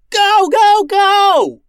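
very high, full-force screamed command; yell. A narrator's reading. Captain urges crew to flee laser fire, yelling. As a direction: scream at them to run. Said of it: "yelled Max".

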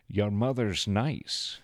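slow, drawn-out film star impression; slow celebrity impersonation; Connery. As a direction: stretch the words out in an impersonated accent. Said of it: "That was in your Connery voice".

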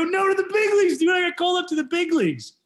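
can't contain the joy; excited. Retold or imitated. Telling his dad on the phone, high elated correction.

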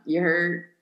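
lightly imitated Cajun guy slang; "You hurt" run together as Louisiana "ya heard"; Cajun athlete. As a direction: toss out a Cajun catchphrase. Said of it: "like, the guys mainly".

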